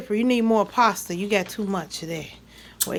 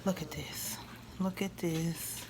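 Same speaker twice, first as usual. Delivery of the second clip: whispered; 5 semitones lower, 15 dB quieter than usual. Real, not acted. Showing food close up.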